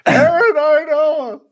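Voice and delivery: high, drawn-out yelled name; screamed name. Pretend. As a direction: belt the name out slowly. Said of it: "This is what John screams out".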